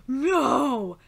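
loud, drawn-out "No!"; shouted refusal. Acted. Manga character refusing talk of friends, shouting. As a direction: bellow it without raising the pitch.

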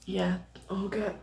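normal talking voice

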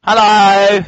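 high, loud call-out. Real, not acted. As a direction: call out to get attention. Calling out to co-player.

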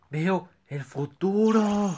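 slow, high mystical drone; mystic seer voice. Pretend. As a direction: intone it like a prophet. Fortune teller filter.

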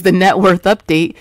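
ordinary talk

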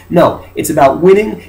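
normal talking voice

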